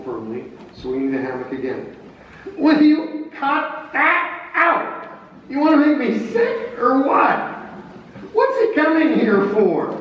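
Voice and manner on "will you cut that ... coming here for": grumpy hungover general. Acted. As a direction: whine at the aide to stop swinging. Grant complaining, hungover.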